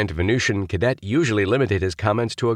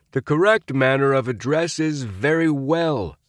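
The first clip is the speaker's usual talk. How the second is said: bawled; 8 semitones higher than usual. A narrator's reading. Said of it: "he bawled".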